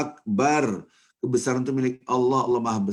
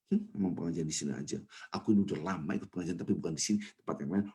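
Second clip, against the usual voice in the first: softer, fast sulky muttering; quick grumbled defence, softer; offended mutter; 4 semitones lower, 7 dB quieter, faster than usual. Retold or imitated. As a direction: rattle off a hurt self-justification.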